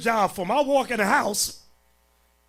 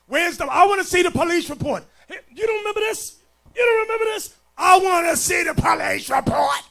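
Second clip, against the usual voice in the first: louder, higher, fast insistent yelling; demanding shout; 6 semitones higher, 5 dB louder, faster than usual. Retold or imitated. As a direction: hammer them for the police report. Preacher demanding proof, retold.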